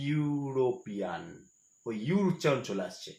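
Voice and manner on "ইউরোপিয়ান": ইউরোপিয়ান drawn out very slowly and softly, yu sound at the start; slow model pronunciation. Shown as a demonstration. Teacher modelling the yu sound of ইউরোপিয়ান for students. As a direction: stretch each syllable of ইউরোপিয়ান and open with a clear yu. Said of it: "ইউরোপিয়ানের উচ্চারণটা কেমন".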